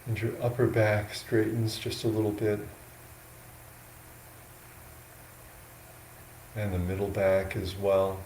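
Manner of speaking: slow, unhurried guidance with long pauses between phrases; guided meditation. Real, not acted. Monk guiding yogis into an upright sitting posture, slow meditation voice. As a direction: talk them gently into posture, a little softly, leaving space.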